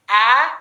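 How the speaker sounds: A as open æ, said loud; a sound. Shown as a demonstration. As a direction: open the jaw wide for A like bat. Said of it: "Left hand side, it is a sound".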